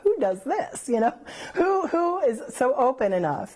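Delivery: replaying her own astonishment at the pastor; amazed disbelief.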